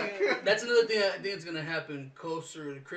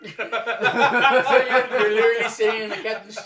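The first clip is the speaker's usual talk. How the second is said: louder, laughing question; 2 semitones higher, 9 dB louder, slower than usual. Real, not acted. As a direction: ask it through laughter. Laughing at missiles ahoy.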